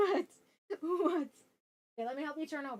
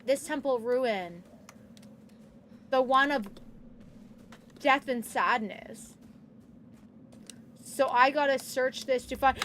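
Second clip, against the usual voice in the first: usual speaking voice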